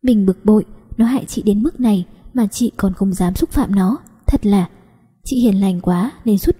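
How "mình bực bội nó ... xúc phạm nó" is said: plain reading voice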